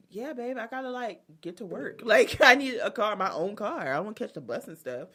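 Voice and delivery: half-hearted excuse voice; lightly voiced boyfriend. Boyfriend explaining why he needs a car, lightly mocked. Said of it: "He was like".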